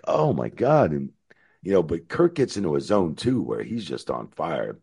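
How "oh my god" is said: high, louder oh my God; stunned. Retold or imitated. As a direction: hit it with sudden shock. Reacting to cauliflower ear.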